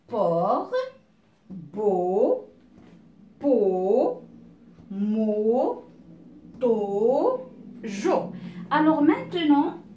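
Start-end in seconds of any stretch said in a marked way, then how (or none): 0.1-8.3 syllable by syllable, drawn out with pauses; reading out syllables. Shown as a demonstration. Teacher reading syllables aloud to pupils, drawn out one by one. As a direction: sound out each syllable slowly and separately. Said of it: "Je vais lire ces syllabes".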